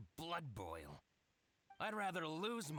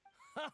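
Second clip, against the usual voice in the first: short high derisive chuckle; sharp mocking snort of a laugh; scoffing laugh. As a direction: laugh off the rival's good name. Merchant scoffs at rival's records.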